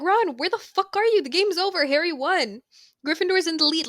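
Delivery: high, excited acted announcement; playing a thrilled fan. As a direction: burst out with the winning news. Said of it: "Like, she's all excited".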